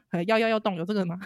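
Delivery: ordinary talk, nothing marked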